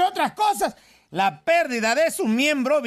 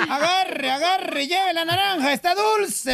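high-pitched hawker's call, drawn-out words; street vendor's cry; 5 semitones higher than usual. Acted. Orange thief hawking stolen fruit in the street, sing-out cry. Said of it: "Y entonces gritaba".

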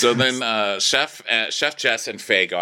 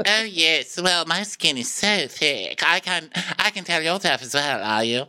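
high mock Faye voice; 8 semitones higher than usual. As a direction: parody of a cheerful stewardess. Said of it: "And Faye's like".

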